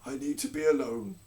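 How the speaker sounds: entranced king dismissing all; dazed king. Acted.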